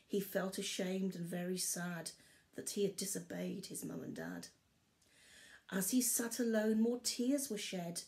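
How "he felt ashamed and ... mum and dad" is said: usual speaking voice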